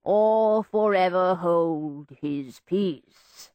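very slow ceremonial intoning; droning vicar. A narrator's reading. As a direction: drone it like a sleepy vicar. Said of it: "the vicar's drone".

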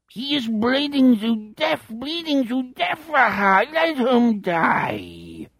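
high, slow, singsong alien croon; extraterrestrial accent. A narrator's reading. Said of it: "accent of an extraterrestrial".

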